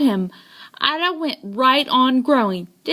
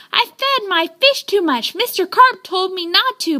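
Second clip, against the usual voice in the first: higher, worried, a touch of panic; anxious confession; 7 semitones higher than usual. A narrator's reading. Boy confesses to the policeman over the phone, upset. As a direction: blurt it out nervously.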